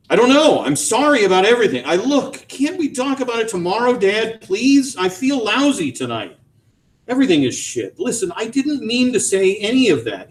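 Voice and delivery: high, anxious begging; distressed pleading. A narrator's reading. Son begging father to stop, high and upset. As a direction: beg to be let off.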